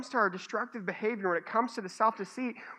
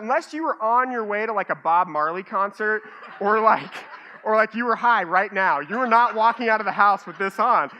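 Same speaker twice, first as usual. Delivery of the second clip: loud mock scolding; 8 dB louder than usual.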